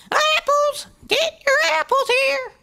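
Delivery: high, loud filly sales cry; loud filly demonstrating how to hawk apples; demo shout. Shown as a demonstration. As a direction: show her how to yell to the market. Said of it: "like this".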